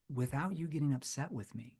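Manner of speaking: gently hesitant and caring; concerned tone. Shown as a demonstration. Salesman leaning in to the prospect, soft and concerned. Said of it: "A concerned tone".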